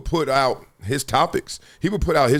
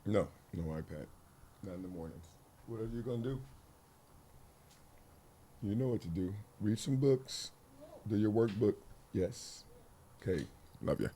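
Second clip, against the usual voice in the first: gentle parent; 4 semitones lower, 15 dB quieter than usual. Real, not acted. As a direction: kind no to the iPad. Parent setting morning rules.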